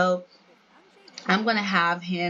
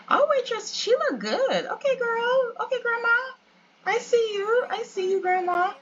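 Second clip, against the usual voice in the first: high, excited squeal of approval; playful high-pitched hype for the waitress; hyped teasing praise. Real, not acted. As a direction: cheer her on like a friend, voice way up.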